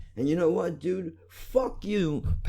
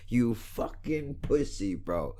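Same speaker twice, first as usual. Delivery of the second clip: ordinary delivery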